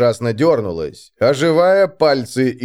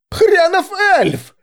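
very high, drawn-out curse; hostile shout; 4 dB louder, slower than usual. A narrator's reading. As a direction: yell the insult from the crowd. Crowd member curses the elf.

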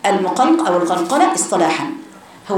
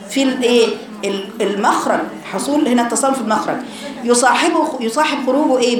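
ordinary talk

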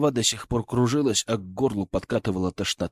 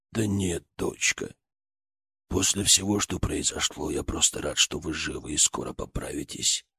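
low soft father; 8 semitones lower, 6 dB quieter than usual. Acted. Father voiced.

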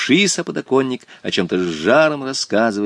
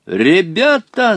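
high, drawn-out rallying yell; fierce shout; 10 semitones higher, 5 dB louder, much slower than usual. A narrator's reading. Boy summoning the neighbour boys, shouting fiercely. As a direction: holler for the gang. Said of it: "закричал он вдруг свирепо".

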